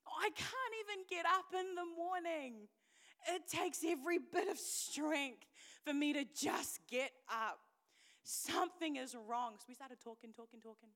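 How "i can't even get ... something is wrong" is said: loud, worked-up complaint; retold desperation. Woman telling her leader she can't cope, desperate. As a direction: plead loudly, as if at your limit.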